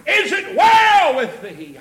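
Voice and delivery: loud, high preacher's call; crying out. Retold or imitated. Preacher voicing Elijah hailing the woman, crying out. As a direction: call out each question, stretching the key word. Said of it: "he cried and said".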